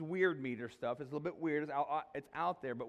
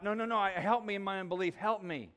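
loud, high, desperate plea to be helped; crying out; 6 semitones higher, 7 dB louder than usual. Retold or imitated. The father crying out to Jesus for help, loud desperate plea. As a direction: cry out for help with your unbelief. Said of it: "the man cries out".

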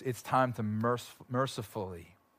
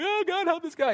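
a high, pleading cry of Oh, God, help this guy; crying prayer. Retold or imitated. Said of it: "I'm just crying out to God".

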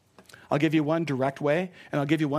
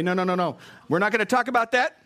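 louder, higher sassy refusal; attitude; 6 semitones higher, 5 dB louder than usual. Shown as a demonstration. Preacher demonstrates refusing gossip with sassy attitude. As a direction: shut them down with sass. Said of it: "That's the attitude".

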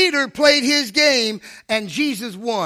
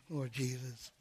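very quiet, low muttered plea; hushed, sinking appeal; dismayed private prayer; 10 semitones lower, 17 dB quieter, slower than usual. Retold or imitated. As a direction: mutter it to heaven under your breath.

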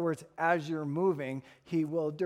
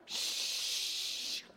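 long airy shush; soft, sustained "shh"; 8 dB quieter than usual. Acted. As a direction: hush the child with a drawn-out hiss. Dad hushing the boy.

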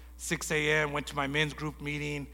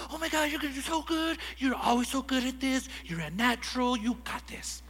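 high, fast, gushing praise; gushing friend; 10 semitones higher than usual. Retold or imitated. The girl at the barber gushing praise, high and fast. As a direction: gush over him like a fan.

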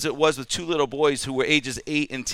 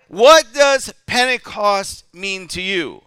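louder, higher question to the congregation; loud challenge; 6 semitones higher, 10 dB louder, slower than usual. Acted. Preacher challenges the congregation, loud and slow. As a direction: challenge every listener directly.

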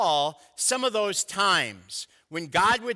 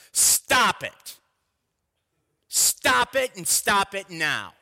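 loud, sharp, stern order; firm command; 2 semitones higher, 4 dB louder, slower than usual. Shown as a demonstration. God telling his people to stop fearing, stern loud command. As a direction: bark it like a parent who means it. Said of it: "more like this".

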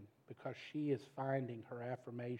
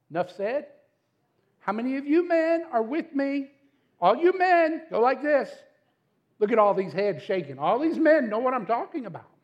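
much higher and louder, rallying the crowd; fired-up shout; 10 semitones higher, 14 dB louder than usual. Real, not acted. Pastor rallying the men in church, loud shout. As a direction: call out to the men for agreement.

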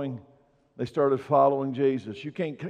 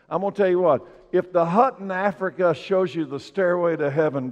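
louder, rising preacher's proclamation; full-force preaching; 3 semitones higher, 3 dB louder than usual. Acted. Pastor proclaiming Jesus to the church, full-force preaching. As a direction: drive it home to the congregation.

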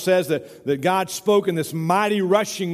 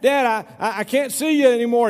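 higher, louder stammered call to Dad; retold holler; 6 semitones higher, 4 dB louder than usual. Farmer's son hollers back from the well, mock holler. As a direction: imitate a boy hollering back across the dark. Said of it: "and he hollered back".

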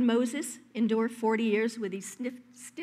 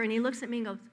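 ordinary talk, nothing marked

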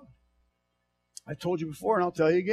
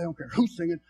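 ordinary talk

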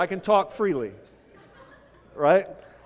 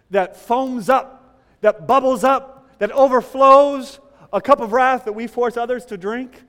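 rising, louder preaching, foams up drawn out slow; building preaching; 6 semitones higher, 10 dB louder than usual. Real, not acted. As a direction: climb in force line by line.

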